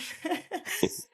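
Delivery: a high, breathy little laugh; soft giddy laugh. Real, not acted. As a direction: let a quiet, happy laugh slip out. Joyful after hug.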